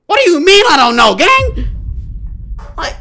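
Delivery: high, loud disbelieving yell; loud stunned yell at a friend; incredulous shout. Real, not acted. Incredulous outburst again.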